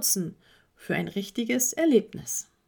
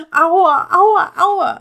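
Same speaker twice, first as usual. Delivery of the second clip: loud, high cries of pain; crying out in pain; 9 semitones higher, 12 dB louder, much slower than usual. Acted. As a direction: yelp as the hoof gives way. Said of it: "rief Paul".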